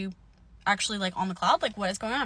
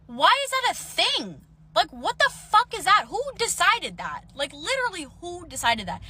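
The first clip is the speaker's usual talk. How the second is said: high-pitched, exasperated questioning; incredulous outrage; 7 semitones higher than usual. Retold or imitated. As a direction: demand answers in indignant disbelief. Ranting at the cop's ruling.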